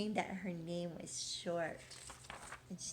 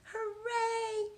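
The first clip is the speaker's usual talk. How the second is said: high, bright cheer of joy; excited cheer; 12 semitones higher, 4 dB louder than usual. Acted. Girl cheering on her first school day, high excited whoop. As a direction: whoop it out with glee. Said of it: "said Chrysanthemum".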